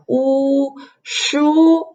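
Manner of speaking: U and SHU held high and level; first tone. Shown as a demonstration. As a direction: sustain "oo", then "shoo", on one high flat note. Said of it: "the first tone is like u".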